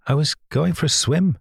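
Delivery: sniffling. A narrator's reading. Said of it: "she sniffed".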